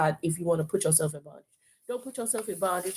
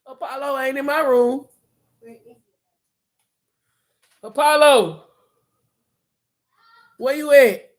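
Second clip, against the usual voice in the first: calling out; 6 semitones higher, 12 dB louder than usual. Real, not acted. Calling out for Apollo in the house.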